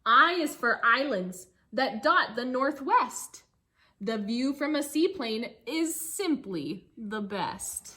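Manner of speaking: lively storybook reading for a class; reading to children. Acted. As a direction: read the rhyme aloud to the kids, bouncy. Teacher reads picture book aloud.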